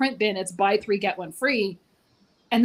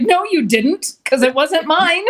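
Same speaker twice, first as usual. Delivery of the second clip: mock indignant retort; 6 semitones higher, 6 dB louder, slower than usual. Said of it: "It's like".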